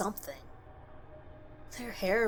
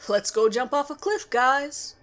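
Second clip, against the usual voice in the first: bright, louder, higher playful call; playful invitation; 5 semitones higher, 8 dB louder than usual. Retold or imitated. Teacher luring the class along, playful and louder. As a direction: sell a crazy idea like it's fun. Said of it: "He had that tone of voice that makes you want to respond".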